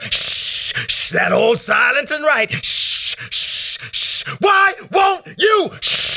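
high shouted lines broken by hushed shushes; poetry performance. Acted. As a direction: shout a line, then hush the room. Shush-and-shout section of poem.

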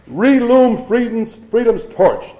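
high, drawn-out rallying cry; slow, high oratorical shout. A narrator's reading. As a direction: shout it like a slogan, pitched high. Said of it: "Doctor Sutherland shouted".

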